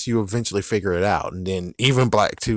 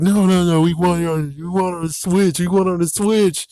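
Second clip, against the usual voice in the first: higher, louder bratty whine; mock a crowd of pestering fans; mocking whiny fans; 7 semitones higher, 4 dB louder than usual. Mocking fans who want it on the Switch, higher bratty whine. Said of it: "you guys are like".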